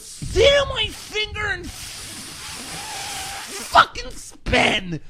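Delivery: high, slow, drawn-out mock yell; mock shrieking troll. Keemstar quoting a troll's insult in a shrill screech. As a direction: stretch it out in a shrill hater screech. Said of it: "He goes".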